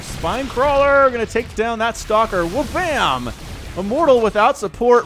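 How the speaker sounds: excited play-by-play over a scrappy fight. Real, not acted. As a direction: call the fight with rising excitement.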